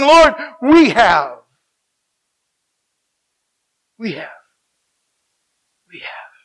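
from a slow, loud cry down to a whisper; fading confession. Acted. Daniel confessing to God, fading from cry to whisper. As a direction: let the cry fade to nothing.

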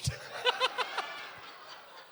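high breathy burst of laughter; real laugh at his own joke. Real, not acted. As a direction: let the laugh squeal out. Punchline of the dog food story.

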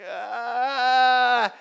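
high, caught-out groan; freeze, caught out; awkward stalling ah. Retold or imitated. Pastor caught not remembering, awkward high ah. Stuck, can't recall name.

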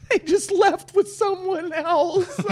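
high, slow squeal breaking into laughter; gleeful mock news-breaking. Recapper telling a jilted contestant they were left, high laughing delivery. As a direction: crack up while delivering the bad news.

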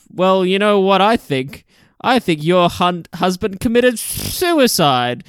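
slow, deliberate quote ending on a hissed "suicide"; mock a ponderous actor, lingering on the last word; imitating film character. Retelling the boss's theory to the widow, slow imitation. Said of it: "and he says".

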